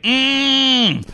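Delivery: high approving mm; a mock interested hum; amused hum. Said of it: "so he's like".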